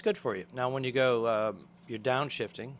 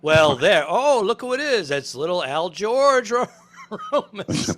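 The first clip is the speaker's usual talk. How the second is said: loud, high-pitched theatrical welcome; hammed-up greeting; 6 semitones higher, 8 dB louder, slower than usual. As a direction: greet a little arrival with big, showy volume. Acted greeting in a story bit.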